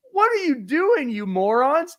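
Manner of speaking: put-on high, loud exasperated yell at the kids; mock exasperation. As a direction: scold the dumb kids in disbelief. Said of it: "I was like".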